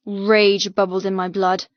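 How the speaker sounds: ordinary delivery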